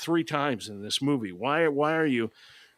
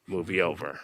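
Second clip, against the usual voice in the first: low, flat, weary deadpan; 8 semitones lower, 5 dB quieter than usual. Pretend. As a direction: drop it like you're fed up. Deadpan end after more plot.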